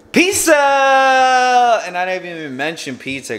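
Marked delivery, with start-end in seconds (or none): 0.1-1.8 high, loud, drawn-out mimicking cry; long teasing holler, pitched way up; mock excited yell. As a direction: imitate someone yelling it with hammed-up glee. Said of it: "the last time she was like".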